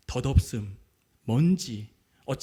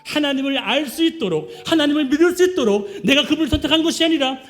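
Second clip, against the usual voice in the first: louder, forceful blessing from the pulpit; fervent preaching; 3 semitones higher, 6 dB louder than usual. Acted. Pastor blessing the congregation, forceful preaching. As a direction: proclaim it with full conviction.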